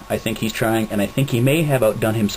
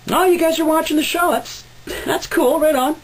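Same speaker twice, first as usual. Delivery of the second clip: way higher, giddy and bubbly; high excited voice. Acted. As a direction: gush like a starstruck fan. Host fakes excited reply.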